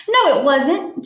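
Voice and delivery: indignant denial. Acted. Tilly argues back at her friend, indignantly. Acted quarrel in a story.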